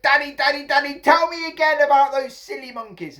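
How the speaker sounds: put-on high, loud child's plea; excited little boy. A narrator's reading. Little boy begging Daddy for the story, excited. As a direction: beg eagerly in a little boy's voice.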